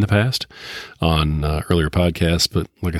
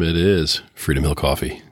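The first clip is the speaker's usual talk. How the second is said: ordinary delivery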